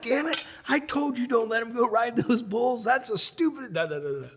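very high, sing-song angry mother; high sing-song mother scolding dad about the bulls; scolding mom. Retold or imitated. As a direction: rant like a furious mom. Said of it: "my mom's like".

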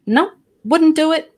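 high-pitched mock refusal of a doctor; imitated refusal. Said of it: "her doctor refused".

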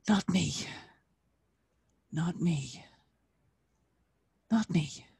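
unvoiced repeated whisper; man whispering his guilty relief. A narrator's reading. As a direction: breathe it out under your breath. Yefim's relieved private thought.

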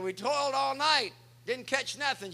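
loud, high call back across the water. Acted. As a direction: yell it back, worn out from the night. Fishermen call back from boat.

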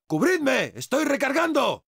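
high-pitched cry for cover; urgent battle call. Acted. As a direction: call out urgently to your squad while reloading. Calls squad for cover while reloading.

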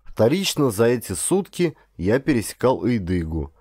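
plain reading voice

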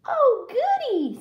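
very high, loud granny voice; excited storybook grandmother; delighted Granny. Acted. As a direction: exclaim with piping glee. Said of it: "said Granny".

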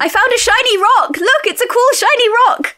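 put-on high, excited young dragon voice; mock childlike excitement, pitched high; Temeraire. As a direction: play a childlike dragon showing off a find. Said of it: "Temeraire is just asking dumb things".

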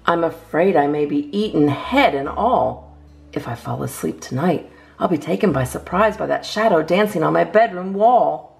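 ordinary delivery

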